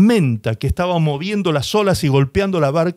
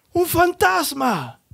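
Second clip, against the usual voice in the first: high, slow, drawn-out cry of fear; frightened cry; 12 semitones higher, much slower than usual. Acted. Disciples in the boat cry out at the ghost, high and slow in fear. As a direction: cry out as if seeing a ghost on the water. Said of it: "y dieron voces de miedo".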